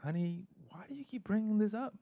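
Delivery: husband whining to his wife, in a high put-on voice; husband's voice. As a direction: pitch it way up like a pleading husband. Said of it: "one day he was like".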